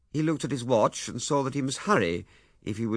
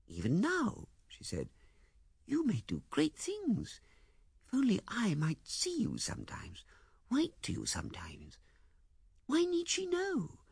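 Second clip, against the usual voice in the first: higher, pleading woman's voice; Miss Heydinger; 7 semitones higher, 4 dB quieter than usual. Acted. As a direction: plead softly as the hurt woman. Female character voice in narration.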